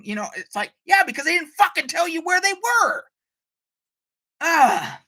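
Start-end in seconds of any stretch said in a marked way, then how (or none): 0.9-3.1 high, incredulous outburst; exasperated retort. Acted. Fan answering Riker who can't find the team, exasperated outburst. 4.4-5.0 higher, louder frustrated "ah"; exasperated groan. Real, not acted. Fan fed up with lazy writing, exasperated groan.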